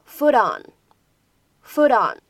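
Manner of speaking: "foot on" with the d of food linked into "on"; linking. Shown as a demonstration. Teacher modelling "food on" linked as "foot on". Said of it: "可以做一个连读".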